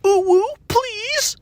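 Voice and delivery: very high, drawn-out pleading; mock cutesy begging. Mimicking the kid asking for a vape, cutesy begging. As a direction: beg in a silly squeaky falsetto.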